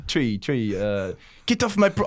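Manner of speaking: Irish accent attempt. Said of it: "Have another go".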